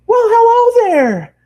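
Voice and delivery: very high, slow, drawn-out squeal. Acted. Bubbly girl squeals hello to the traveler. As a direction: squeal it like an overjoyed pony girl. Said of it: "she squealed".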